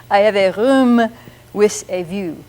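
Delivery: slow mock French accent; French r and s for th, demonstrating a French accent. Shown as a demonstration. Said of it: "make it sound more like a French accent".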